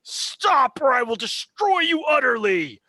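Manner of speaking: booming outraged entity threatening destruction; furious deity. Acted. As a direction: roar down at the intruders like an enraged god. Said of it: "Balthior appears in all of his fury".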